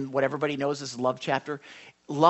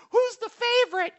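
put-on much higher, louder whiny child voice; kid asking; 7 dB louder than usual. Kids pester their parent over favorites, high child voice. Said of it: "You ever have your kids".

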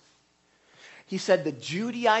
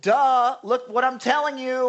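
higher, louder, mocking rebuke; mocking duh; 5 semitones higher, 5 dB louder, slower than usual. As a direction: talk down to them like slow students.

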